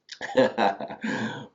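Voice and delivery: brief self-deprecating chuckle; short laugh. Real, not acted. Teacher to class after a four-minute summary, light laugh. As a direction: laugh lightly at your own rushed summary.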